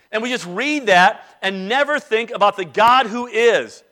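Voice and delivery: normal talking voice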